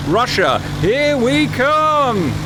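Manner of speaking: high, very slow triumphant call; drawn-out cheer. Pretend. To the viewers as they head for Russia, a slow high cheer. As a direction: stretch it out like a road-trip whoop.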